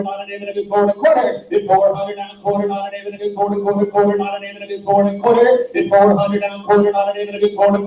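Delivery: fast rolling auction chant; auctioneer. Acted. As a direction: rattle off bids like an auctioneer.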